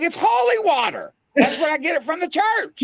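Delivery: high, exasperated set-the-record-straight voice; exasperated explaining. Retold or imitated. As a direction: squeak it out in disbelief.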